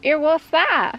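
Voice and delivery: a hint of the puzzled tradesman; carpenter character. Carpenter questioning his lord, lightly put-on character voice. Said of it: "you be Bert".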